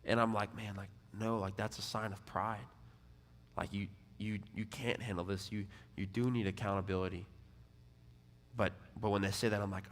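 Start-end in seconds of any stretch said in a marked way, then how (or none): 0.5-7.2 soft, lower, earnest pleading; gentle counsel. Retold or imitated. Pastor softly pleading with a proud friend, tender. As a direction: quietly urge him to accept help.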